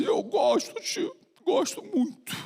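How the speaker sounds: put-on high little boy's reply; child voice. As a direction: say it like a small boy. Child answers the doctor. (voz de criança)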